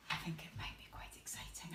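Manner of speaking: fast suspenseful whisper. Acted. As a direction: build suspense in a hush.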